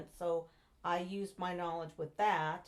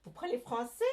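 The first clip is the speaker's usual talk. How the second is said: put-on lightly French-accented question; mock French shopkeeper asking her; imitating a French local; 3 semitones higher than usual. French local greets an American tourist, lightly French-accented question.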